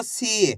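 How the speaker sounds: सी with a long, falling ee; drawn-out vowel. Shown as a demonstration. Teacher drilling the long matra, सी drawn out. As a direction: stretch the ee in सी. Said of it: "खींच खींच के उच्चारण".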